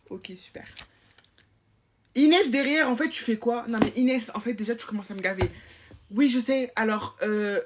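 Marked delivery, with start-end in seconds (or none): 0.1-0.7 soft whispered aside; whispered ok to close the deal. Real, not acted. Quiet aside after setting detention. 2.1-5.5 loud, high telling-off; scolding. Real, not acted. Supervisor scolding a pupil.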